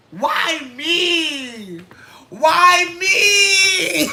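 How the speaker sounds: retold anguished street scream; screaming why me. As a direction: scream it at the sky, hammed-up. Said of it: "And I'm screaming it".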